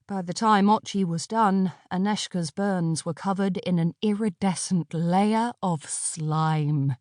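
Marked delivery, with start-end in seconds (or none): none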